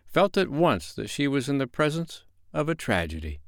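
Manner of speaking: plain reading voice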